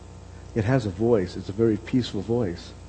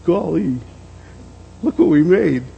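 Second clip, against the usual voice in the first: high, louder burst of wonder; amazed new parent; 8 semitones higher, 4 dB louder than usual. Retold or imitated. New father looking at his firstborn, amazed. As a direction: marvel aloud at what you made.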